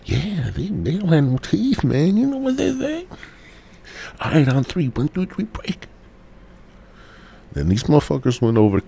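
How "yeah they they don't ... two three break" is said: put-on high, eager kid voice bossing his buddies; mock kid leader. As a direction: play a scheming little kid giving orders. Kid voice planning huddle.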